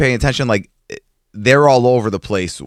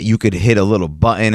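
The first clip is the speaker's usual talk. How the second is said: usual speaking voice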